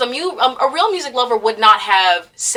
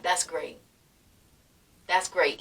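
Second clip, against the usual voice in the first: soft encouragement; 3 semitones lower, 9 dB quieter, slower than usual. Shown as a demonstration. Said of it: "just to be like".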